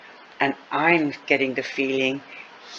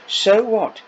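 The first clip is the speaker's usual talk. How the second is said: high and louder, brushing it off; dismissive retort; 7 semitones higher, 5 dB louder than usual. Acted. Fiona brushing off her friend's fear, loud dismissive retort. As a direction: shrug it off with a loud challenge.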